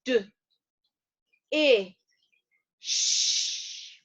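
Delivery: "D, I, sh" as three isolated phonemes, not letter names; sounded out. Shown as a demonstration. Teacher leading learners through a new word, sounded out. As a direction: break "dish" apart slowly with pauses, holding the sh. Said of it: "where do we think we hear that sound".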